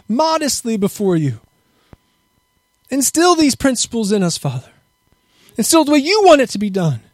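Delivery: steady prayer voice that swells and sinks; fervent prayer. Acted. Pastor prays over the men before Sukkot, fervent and rising. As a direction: pray it over the people, rising to a cry.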